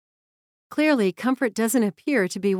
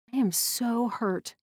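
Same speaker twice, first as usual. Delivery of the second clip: hurt confession; 3 semitones higher, 5 dB quieter, slower than usual. Retold or imitated. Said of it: "I said a short prayer".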